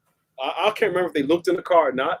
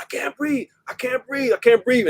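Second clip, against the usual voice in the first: mock-strained cry, pitched up; imitating choking plea; 7 semitones higher than usual. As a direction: plead as if choked, understated. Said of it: "like, really choking him".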